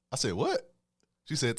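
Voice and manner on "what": high, rising retold double-take; mock-disbelieving question, pitched up; incredulous. Storyteller replaying his reaction to her name, incredulous.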